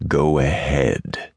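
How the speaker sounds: low, slow growl; menacing growl. A narrator's reading. Cowboy dares the lady to hit him, low menacing growl. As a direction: growl it as a dare. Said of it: "his voice a menacing growl".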